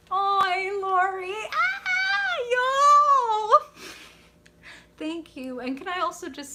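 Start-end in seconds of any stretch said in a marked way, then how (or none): 0.1-3.8 very high, loud outcry; overjoyed squeal. Real, not acted. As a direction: squeal with delight at a friend. Overjoyed reaction to a viewer.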